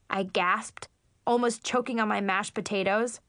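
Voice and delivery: ordinary delivery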